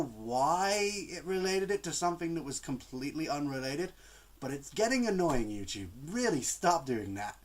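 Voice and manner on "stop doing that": ordinary talk, nothing marked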